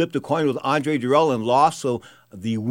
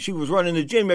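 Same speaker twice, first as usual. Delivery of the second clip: normal talking voice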